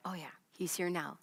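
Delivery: murmured softly to oneself; soft, inward reassurance; quiet repeated reminder to oneself through the day; quiet self-reminder. Acted. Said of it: "you remind yourself".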